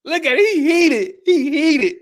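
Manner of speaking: high, loud and cracking with laughter; laughing out loud. Real, not acted. Teasing his worked-up friend, high laughing shout. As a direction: crack up while teasing him.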